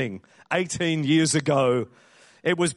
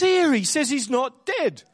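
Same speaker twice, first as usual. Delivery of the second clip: raised-pitch comic cart man; Eric Idle; 9 semitones higher than usual. Retold or imitated. Cart man querying the man carrying his father, comic role voice. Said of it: "Eric Idle says".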